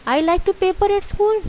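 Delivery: high-pitched, questioning echo; incredulous question. Shown as a demonstration. Teacher tests the wrong sentence on pupils, questioning. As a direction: repeat it high, as if it cannot be right.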